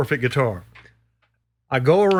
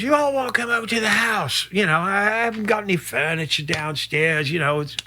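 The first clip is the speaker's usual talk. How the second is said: higher, lightly voiced casual invitation; imitating Steve; 6 semitones higher than usual. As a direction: mimic the host offering his empty house. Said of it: "and he goes".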